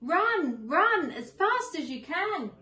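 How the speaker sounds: high, loud sing-song taunt; cheeky gingerbread man taunting his chasers; taunting call. Acted. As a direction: tease them as you run off. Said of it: "he called".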